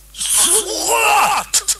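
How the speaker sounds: a demonic screech; possessed girl spitting an insult; croaked demon shout. A narrator's reading. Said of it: "Regan croaked at her".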